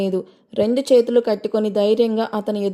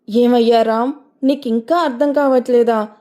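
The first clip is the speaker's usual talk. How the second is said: ordinary delivery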